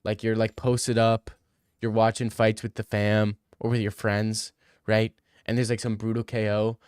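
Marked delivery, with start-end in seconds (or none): none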